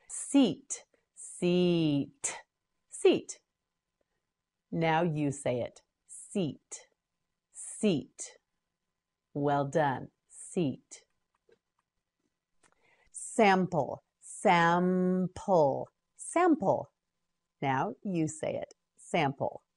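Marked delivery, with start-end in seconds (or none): 1.2-2.4 "Seat" with long ee, separate t; slow modelling. Shown as a demonstration. Teacher models word slowly. 14.2-15.9 "Sample" slowly as sam, pul; syllable by syllable. Shown as a demonstration. Teacher models word by syllables.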